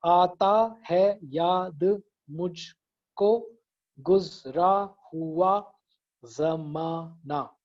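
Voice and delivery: very slow, drawn out syllable by syllable; deliberate syllable-counting demo. Shown as a demonstration. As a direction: count out each syllable, stretching it. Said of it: "ताकि आपको समझ में आए कि syllable क्या होता है".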